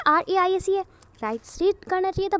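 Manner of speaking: ordinary delivery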